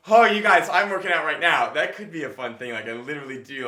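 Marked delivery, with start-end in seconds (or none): 0.1-1.7 mock excited gym guy. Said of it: "and I'm like".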